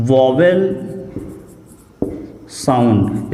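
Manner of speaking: slow, word-by-word dictation; dictation pace. Shown as a demonstration. Teacher dictating the definition word by word. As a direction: pause after every word so they can write. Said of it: "तो ये क्या होता है?".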